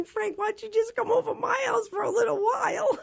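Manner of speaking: high, laughing mock invitation; hopeful fan daydreaming aloud; giggling cheeky invite; giddy inner thought.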